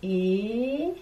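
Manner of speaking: Hélène stretched into its two syllables, one for each circle; showing the class how Hélène fills two circles; syllables drawn out. Shown as a demonstration. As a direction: sound out Hélène slowly for the circles. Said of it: "pour mon prénom, ça fera".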